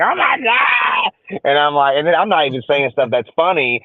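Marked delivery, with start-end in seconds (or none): 0.0-1.4 high, slow gushing oh my God; mock fangirl squeal. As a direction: squeal it like a starstruck mom. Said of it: "And she's like".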